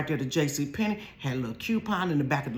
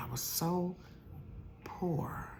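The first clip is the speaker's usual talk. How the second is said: whispered confession; 3 semitones higher, 12 dB quieter, slower than usual. Acted. Telling her viewers how broke she was, whispered. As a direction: drop to a breathy whisper on the one word.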